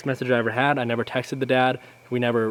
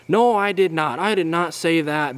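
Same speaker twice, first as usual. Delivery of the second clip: whiny dad denial; 4 semitones higher than usual. Retold or imitated. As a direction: deny it like a whiny, defensive dad. Imitating the dad denying it.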